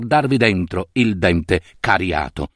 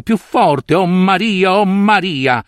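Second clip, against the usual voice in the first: fervent cry; 8 semitones higher, slower than usual. Acted. Bobbio alone, crying out to Mary in a fervent, higher voice. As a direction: cry out to the Virgin as the pain peaks. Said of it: "con voce non sua, con fervore non suo".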